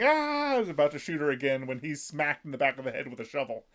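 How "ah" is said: high-pitched cry, imitating a screamer; imitated scream, held high; mock scream. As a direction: let out a retold "Ah!" scream, pitched well up. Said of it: "he's just screaming".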